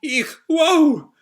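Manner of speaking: high, loud mock panic; hammed-up shock, leaping up in pitch; freaking out. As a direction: squeal like startled minions. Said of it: "the minions all freak out".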